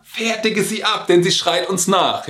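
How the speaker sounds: a hint of fed-up followers; annoyed disciples. Disciples to Jesus, lightly mocked annoyed plea. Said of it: "die sagen ja".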